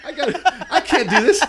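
high, laughing protest; helpless giggly wail; mock panic through laughter. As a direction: pretend to be overwhelmed while cracking up. Acting panic while laughing.

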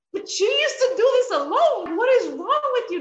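high, loud exasperated husband voice. Retold or imitated. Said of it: "he's like".

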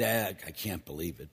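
ordinary delivery

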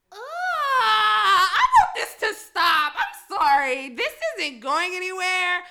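loud, slow, crying whine; whiny cry. Retold or imitated. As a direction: wail it out like a miserable drunk at her limit. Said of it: "I was like".

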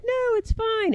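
high-pitched Australian reassurance; Australian accent. Acted. Aaron's girlfriend role reassuring her partner, Australian accent. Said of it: "she's Australian, by the way".

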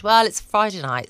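usual speaking voice

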